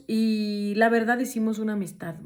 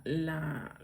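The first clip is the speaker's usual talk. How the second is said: ordinary talk, nothing marked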